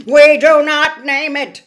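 put-on loud, otherworldly old spider voice; ancient giant spider. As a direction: boom it out like a huge ancient spider. Aragog continues speaking.

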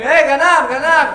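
loud, high crowd yell; shouting. Acted. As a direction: shout out the alarm like bystanders. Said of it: "אנשים צועקים".

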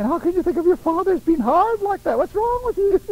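high, rising disbelief; incredulous rebuke. Retold or imitated. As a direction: ask it like you can't believe it. Jesus voiced rebuking.

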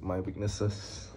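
ordinary delivery

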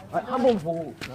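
slurring like a tipsy customer; pretend drunk. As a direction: play drunk, loud and loose. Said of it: "술 취한 척하고".

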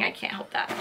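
soft breathy whisper under her breath; whispered aside. Real, not acted. Aside about noisy neighbors.

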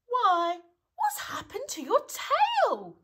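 loud, high-pitched astonishment; surprised. A narrator's reading. Pooh noticing Eeyore's missing tail, in surprise. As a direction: shoot the pitch right up in shock. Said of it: "he said in surprise".

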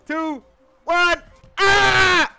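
ordinary talk, nothing marked

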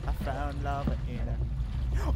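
ordinary talk

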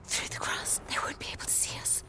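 soft, voiceless whisper. Acted. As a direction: keep it hushed so nobody hears. Hiding from guards in grass.

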